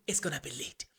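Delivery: hushed whispered aside. Real, not acted. Aside to viewers about the late hearing, whispered. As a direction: drop to a whisper.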